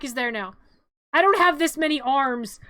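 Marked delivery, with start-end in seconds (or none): 1.1-2.6 loud, flustered complaint; complain, overwhelmed; flustered exclamation. Real, not acted. Juggling game controls.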